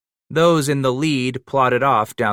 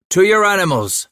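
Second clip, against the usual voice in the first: much higher and louder command; shouted order; 10 semitones higher, 6 dB louder than usual. A narrator's reading. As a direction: send them off with a ringing call. Leader orders men to mount.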